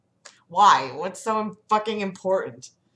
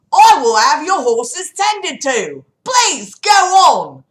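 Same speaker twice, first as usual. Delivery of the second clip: loud, high, drawn-out Cockney; 8 semitones higher, 13 dB louder, slower than usual. Acted. Saria offers to tend horses, loud high Cockney. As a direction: belt it out slowly in hammed-up Cockney. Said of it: "I love doing the Cockney voice".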